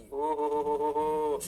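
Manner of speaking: slow, level run of ho-ho-ho; mock-amazed "oh-ho-ho". Teacher marvelling at his own formula, mock amazed. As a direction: draw the oh-ho-ho out evenly.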